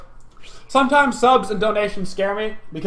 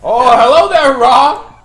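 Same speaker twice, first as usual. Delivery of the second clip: loud; 7 semitones higher, 10 dB louder, slower than usual. Real, not acted. Greeting enemy in game, shouting.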